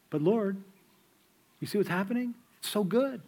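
a believer begging the Lord to keep the good times; pleading believer. Pretend.

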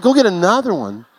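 husband echoing his wife in disbelief; incredulous echo. Retold or imitated. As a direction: throw her words back in disbelief. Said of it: "I said".

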